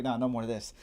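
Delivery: ordinary talk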